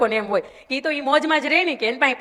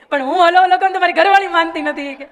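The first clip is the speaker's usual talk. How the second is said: louder, higher mock grumble of an old husband; mock complaining old man; 4 semitones higher, 5 dB louder than usual. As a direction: play the henpecked grandpa, half in jest. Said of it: "બોલો મને કે".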